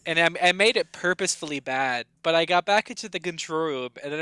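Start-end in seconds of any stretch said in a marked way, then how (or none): none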